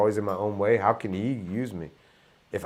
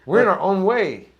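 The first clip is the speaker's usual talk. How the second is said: emphatic; 9 semitones higher, 8 dB louder than usual. Real, not acted. Emphatic point to guests.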